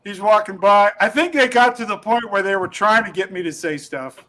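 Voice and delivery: usual speaking voice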